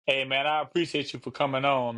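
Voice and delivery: ordinary talk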